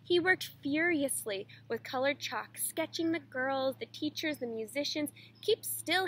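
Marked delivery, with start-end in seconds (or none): 5.4-6.1 higher, mildly barked order; storyteller's restrained shout at fidgety models; mock shout. A narrator's reading. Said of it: "he would shout".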